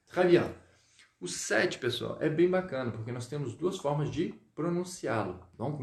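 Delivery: ordinary talk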